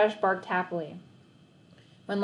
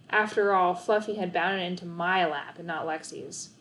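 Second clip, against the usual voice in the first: usual speaking voice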